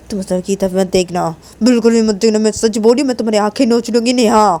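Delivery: accuse, then warn with a threat; jealous girl telling someone off; angry, accusing friend. A narrator's reading. Voices angry Sneha.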